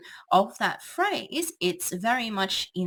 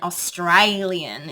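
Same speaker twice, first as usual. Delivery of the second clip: broad Australian; 8 dB louder, slower than usual. Shown as a demonstration. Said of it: "that very broad Australian, accent".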